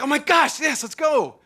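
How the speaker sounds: high, loud delighted yell; thrilled to see you; a father greeting his child with a delighted yell; excited yell. Retold or imitated. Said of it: "he yells".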